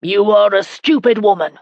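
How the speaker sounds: angry scolding rant; husband ranting at his wife after her rescue. A narrator's reading. Husband ranting at his wife after her rescue. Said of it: "Geoffrey ranted".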